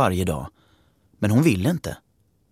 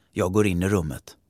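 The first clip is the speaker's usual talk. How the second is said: ordinary talk, nothing marked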